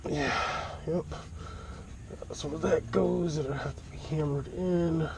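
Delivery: strained, slow talk from under a car; talking on your back under a car; lying under a car. Real, not acted. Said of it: "So I'm under the car".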